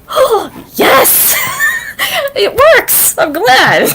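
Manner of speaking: high-pitched gleeful cheer breaking into laughter; giddy, laughing relief; retold delight. Retelling relief when stream worked.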